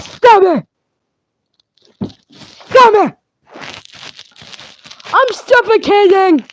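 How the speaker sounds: high, loud hammed-up yelling; mock yell. Yelling at the player hitting him with the box, loud and high. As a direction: scream at them to stop it.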